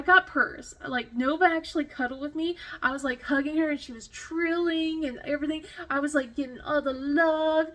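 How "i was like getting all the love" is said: usual speaking voice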